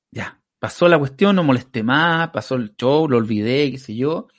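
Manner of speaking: normal talking voice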